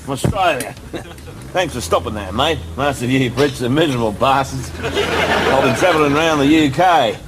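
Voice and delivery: put-on lightly done Australian accent. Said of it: "My name's Brian Logan, from Australia".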